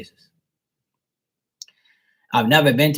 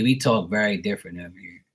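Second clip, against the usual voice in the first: normal talking voice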